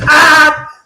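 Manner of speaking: loud, high-pitched screech; sudden piercing yell in imitation; imitated scream. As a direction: scream it like a kid shut outside. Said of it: "just screaming at the fucking door".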